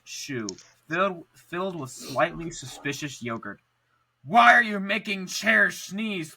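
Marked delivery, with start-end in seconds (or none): 4.2-6.3 demanding shout. A narrator's reading. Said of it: "detective confronts wizard".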